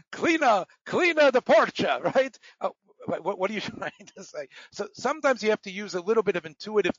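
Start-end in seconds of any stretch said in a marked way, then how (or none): 0.1-2.0 high, loud mock-Spanish accent; badly faked Spanish with vowels tacked on; hammed-up pidgin Spanish accent; fake Spanish. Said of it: "If you're trying to speak Spanish".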